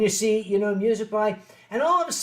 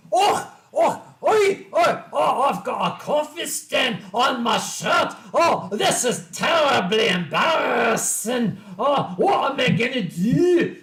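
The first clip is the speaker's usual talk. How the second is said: high, loud, fussy Scottish panic, hammed up; flustered Scottish kid; 4 semitones higher, 4 dB louder than usual. Kid with a coffee stain, loud mock Scottish fluster. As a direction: overplay the embarrassed kid in a Scottish accent. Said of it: "similar to that".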